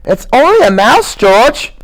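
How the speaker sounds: put-on high stammering childlike plea; Lenny, earnest. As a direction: insist like a scared child. Lenny's held voice.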